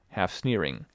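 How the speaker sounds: plain reading voice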